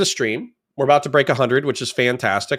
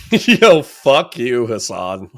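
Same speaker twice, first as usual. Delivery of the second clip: laughing outburst; 4 dB louder, much slower than usual. Real, not acted. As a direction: curse him through real laughter. Cracks up at the accent.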